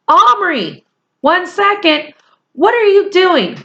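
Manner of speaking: high, loud mother's call. Acted. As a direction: shout up the stairs like a worried mother. Mother calls upstairs.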